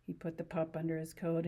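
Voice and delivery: usual speaking voice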